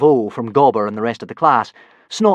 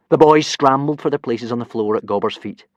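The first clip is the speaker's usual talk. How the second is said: usual speaking voice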